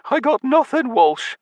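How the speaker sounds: put-on high, reedy old man's voice; old drunkard. A narrator's reading. Patrick pleading with Walsh, high old voice.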